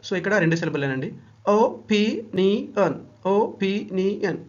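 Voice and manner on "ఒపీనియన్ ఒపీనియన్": Opinion drawn out syllable by syllable, very slow; slow syllable count. Shown as a demonstration. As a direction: spell out each syllable of opinion slowly. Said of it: "four times vowel sound వస్తుందండి".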